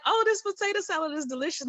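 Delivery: high, quick mock compliment from family; relatives praising. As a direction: gush like a delighted aunt at a cookout. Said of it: "they're like".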